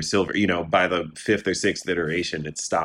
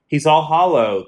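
higher, louder exclamation of a devil in a poem; gleeful devil; 10 semitones higher, 9 dB louder than usual. A narrator's reading. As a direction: recite it as the devil's gloating aside, pitched up. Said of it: "the devil giggles".

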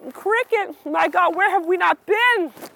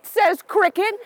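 ordinary delivery

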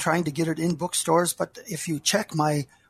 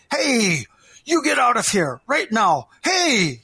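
loud, higher angry shout at a trespasser; angry cry; 5 semitones higher, 5 dB louder than usual. Acted. Old man shouting angrily at an intruder on his field. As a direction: yell him off the field, furious. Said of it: "an angry voice cried".

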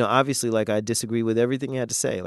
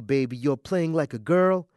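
tender; 5 semitones higher, 4 dB louder than usual. Acted. Father to baby daughter about future taunts, tender. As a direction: quote it to a child without the holler.

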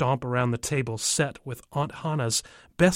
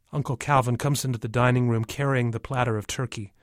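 ordinary delivery, nothing marked

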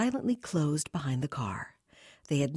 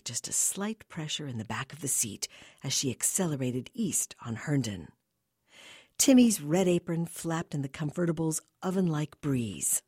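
ordinary delivery

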